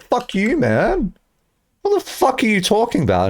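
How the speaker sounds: high-pitched offended retort; mock indignation. Chatter retorting to big streamers, mock indignation. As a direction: protest in exaggerated offence.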